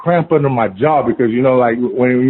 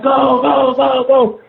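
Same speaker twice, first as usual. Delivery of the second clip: mock scream, urgent and repeated; retold screaming; 11 semitones higher, much slower than usual. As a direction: imitate a friend's frantic screams. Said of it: "He's screaming".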